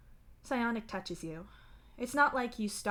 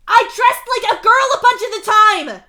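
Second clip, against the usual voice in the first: high, very loud outraged yell; 23 dB louder than usual. A narrator's reading. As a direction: scream it out at full force. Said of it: "he yells".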